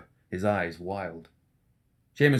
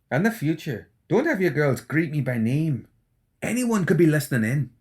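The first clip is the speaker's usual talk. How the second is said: higher, jittery anxious doctor; McMorning, nervous; 7 semitones higher, 4 dB louder than usual. Acted. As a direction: fret that someone is listening. McMorning voiced by narrator.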